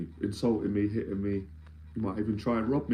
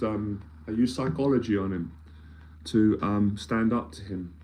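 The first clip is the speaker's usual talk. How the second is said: usual speaking voice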